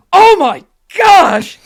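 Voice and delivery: loud, high, slow horrified cry; horrified shout. Acted. Bartender smells the wine and shouts in horror.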